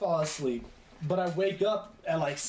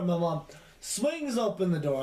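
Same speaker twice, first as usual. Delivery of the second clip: normal talking voice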